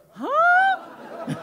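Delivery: high, loud stunned "huh"; hammed-up squeal of astonishment; mock shock. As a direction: imitate a mother's shocked reaction. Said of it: "her face was like".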